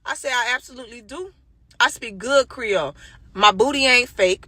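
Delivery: ordinary delivery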